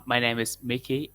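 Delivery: ordinary delivery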